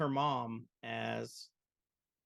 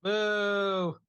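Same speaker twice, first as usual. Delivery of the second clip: loud, high, long boo; mock booing; 7 semitones higher, 7 dB louder than usual. Host echoing the cohost's jeer at Sid, playful booing. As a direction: join the jeer like a heckling crowd.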